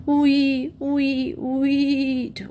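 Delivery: high, piping bird calls; whistling poults. A narrator's reading. Hungry young turkeys calling out, whistling. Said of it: "whistled the turkey plouts".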